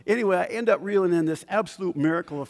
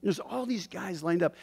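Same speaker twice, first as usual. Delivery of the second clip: usual speaking voice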